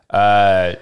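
normal talking voice

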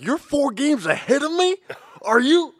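high, loud whiny mimic of his co-host; hammed-up incredulous co-host; mock Phil voice. Son mocking his dad's shock at being behind. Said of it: "You're like".

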